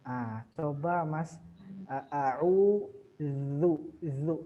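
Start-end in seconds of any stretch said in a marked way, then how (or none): 1.9-3.7 "a'udzu" very slow with the dz made tongue tip to teeth; clear dh sound. Shown as a demonstration. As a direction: draw out "a'udzu", biting the z.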